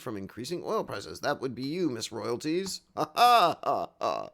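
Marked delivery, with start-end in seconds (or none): 3.0-4.3 hearty real laugh; loud laugh. Real, not acted. Laughing at joke.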